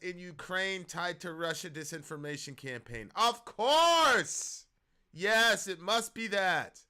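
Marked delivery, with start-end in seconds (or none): 3.1-6.8 loud, high mock agreement, the last words slow; sarcastic loud "of course". Real, not acted. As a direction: pretend to believe it, loudly. Mocking the fact-check headline.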